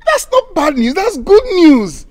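high, loud delight; excited. Acted. Man delighted his girlfriend is pregnant, loud and excited. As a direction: burst out with the good news.

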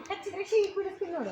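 sing-song teasing on ಹೀಗ್; mock the kid, tease it out; teasing mockery. Shown as a demonstration. Director showing the actor a teasing taunt. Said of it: "ಇನ್ನು Kindle ಮಾಡಿ".

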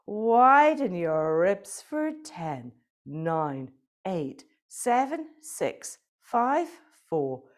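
rhythmic counting cues, up and down; breath counting. Acted. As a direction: chant the breath counts like an instructor.

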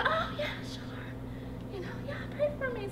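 small, high-pitched quoted voice; high, soft acceptance. Retold or imitated. Woman accepting an offer of prayer, high and soft. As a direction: imitate her agreeing, light and soft.

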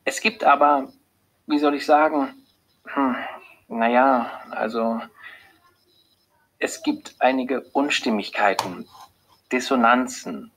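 faltering, searching for the words; uneasy doctor stalling before bad news; hesitant. A narrator's reading. Doctor hesitantly hinting at bad test results. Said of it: "fühlt sich nicht gut in ihrer Rolle".

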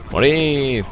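morid alargado y agudo; grito de ataque fingido. Pretend. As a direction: alarga la orden como grito de juego. Grita a los enemigos en partida. (grito de guerra juguetón)

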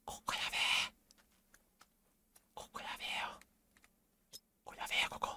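hushed whisper. Real, not acted. Hiding near an enemy, whispering to himself. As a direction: breathe it out, barely voiced.

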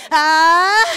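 "ah" fuerte y sostenido, subiendo; gemido sostenido. Shown as a demonstration. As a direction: suelta el tono y sostenlo. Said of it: "aunque te interrumpan". (gemido fuerte y agudo)

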